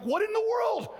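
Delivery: high, loud shout of disbelief; outraged shout. Retold or imitated. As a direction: yell it in shock at the field. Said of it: "I'm mad 'cause I'm like".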